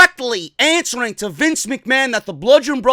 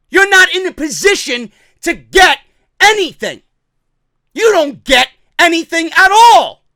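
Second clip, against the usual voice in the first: raised, loud indignant outburst; angry rant; 5 semitones higher, 6 dB louder than usual. Real, not acted. Fan scolding a wrestling promoter, angry rant. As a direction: lay into the boss, voice high and loud.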